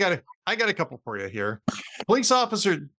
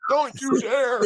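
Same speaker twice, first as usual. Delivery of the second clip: mock outrage; 8 semitones higher, slower than usual. Threatening friends about burial suit.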